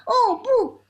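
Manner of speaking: high, loud cry of alarm; scream. A narrator's reading. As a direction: yelp it out in alarm. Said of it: "特鲁鲁先生尖叫一声".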